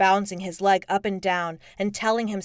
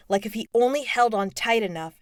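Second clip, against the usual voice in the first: usual speaking voice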